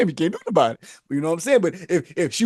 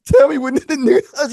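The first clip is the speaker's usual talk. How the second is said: repeated demand; 7 semitones higher, 5 dB louder than usual. Retold or imitated. Angry demand, repeated louder.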